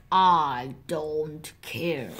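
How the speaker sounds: low, slow, soft brush-off; uninterested refusal. Acted. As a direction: drag it out wearily, quite unbothered. Mommy fox brushes off bored chicks.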